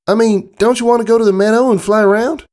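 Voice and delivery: high filly voice, coaxing and pleading. A narrator's reading. Apple Bloom coaxing Scootaloo to fly instead, pleading. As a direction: coax her toward the meadow. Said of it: "asked in a pleading tone".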